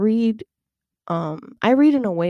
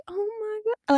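delighted gasp; 5 dB quieter, much faster than usual. Retold or imitated. Delighted inner reaction.

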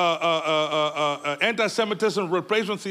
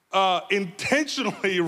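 normal talking voice

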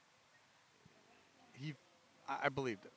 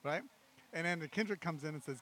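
ordinary talk, nothing marked

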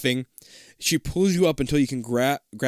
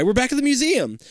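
high, excited exclamation; excited cry; 11 semitones higher, 3 dB louder than usual. A narrator's reading. You cry out to Liz on reaching the lobby, excited cry. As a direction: cry out the happy discovery. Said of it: "you cry".

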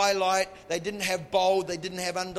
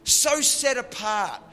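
full-force preaching; 5 semitones higher, 5 dB louder, slower than usual. Acted.

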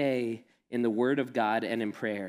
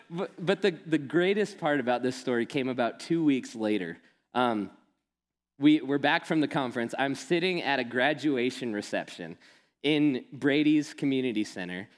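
usual speaking voice

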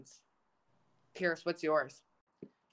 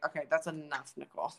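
lightly voiced weary reprimand; lower, softer, putting a stop to it; Tristan; 4 semitones lower, 6 dB quieter than usual. Imagining Tristan cutting off the teasing, lightly imitated reprimand. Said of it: "Tristan's gonna be like".

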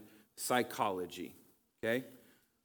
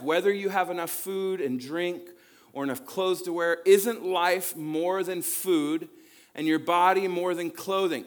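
higher and louder, a full pulpit reading; preaching scripture; 6 semitones higher, 7 dB louder than usual. Acted. Pastor reads the verse to the congregation, preaching. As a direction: proclaim each question to the hall.

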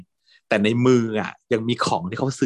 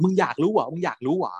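taunting challenge; 7 semitones higher than usual. Retold or imitated. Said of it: "ท้าทาย".

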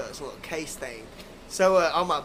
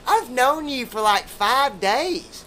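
higher and louder, the last words stretched slow; incredulous retort; 5 semitones higher, 4 dB louder, much slower than usual. Retold or imitated. Telling the clingy date how brief it's been, incredulous. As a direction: drag out five days in disbelief.

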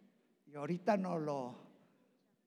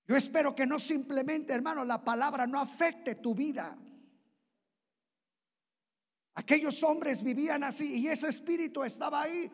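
higher and louder, fervent sermon; preaching at full force; 9 semitones higher, 6 dB louder than usual. Acted. Preacher urging the church, loud and fervent. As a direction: lift the congregation, preach hard.

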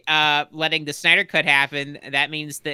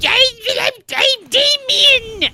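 very high, slow whiny brat; whiny kid voice; 7 dB louder, slower than usual. Pretend. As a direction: whine it like a spoiled kid. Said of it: "He's gonna get your best whiny voice".

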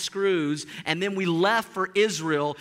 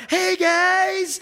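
much higher, louder breezy hello; cheery greeting; 11 semitones higher, 6 dB louder than usual. Retold or imitated. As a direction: roll up carefree as if nothing happened. Friend rides up cheerfully.

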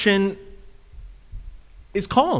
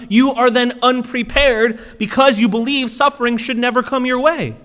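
ordinary delivery

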